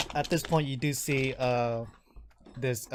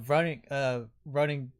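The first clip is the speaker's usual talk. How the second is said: ordinary delivery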